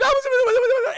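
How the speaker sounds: high, loud mock wail; hammed-up frightened outburst; panicked cry. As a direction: imitate a dog in a panic. Dog panics to owners.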